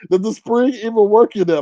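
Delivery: laughing through the question. Real, not acted. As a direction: giggle while asking it. Laughing at a gun's spring.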